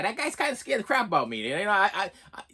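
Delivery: usual speaking voice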